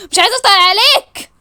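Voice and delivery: loud, high-pitched snap; angry outburst. Acted. Fed up with him while fasting, angry outburst. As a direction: throw it at him, pitch climbing.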